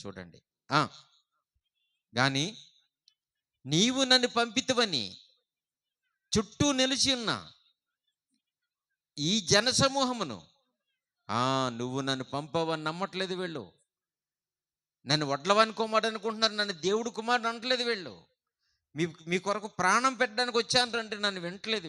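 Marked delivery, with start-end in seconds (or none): 2.2-10.4 much higher, louder, slow and solemn; fervent scripture reading. Acted. Preacher reading Jesus' prayer aloud to the congregation, fervent and slow. 15.1-21.9 pleading complaint. Acted. Jesus' complaint, voiced.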